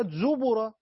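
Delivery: زبر said slowly as "zubura"; u after the ب in زبر; damma on the ب. Shown as a demonstration. Teacher moves to this reading, damma on the ب in زبر. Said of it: "بضم الباء".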